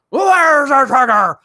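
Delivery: over-the-top movie vigilante yell, slow and loud; Bale Batman voice. Retold or imitated. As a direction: bark it out like Batman interrogating. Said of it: "doing that Bale Batman voice".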